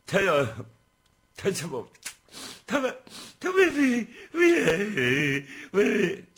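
high, loud blubbering stammer; crying. Acted. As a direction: sob and choke on each word. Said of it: "别哭哎".

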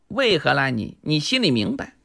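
low, hushed answer; knowing retort. Acted. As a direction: drop the voice, hinting at a shared secret.

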